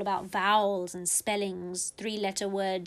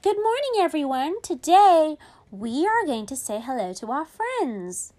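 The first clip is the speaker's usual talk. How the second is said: higher teacher greeting; cheery teacher; 6 semitones higher, 6 dB louder than usual. Retold or imitated. Teacher greeting her online class, higher cheery voice. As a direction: welcome the class brightly.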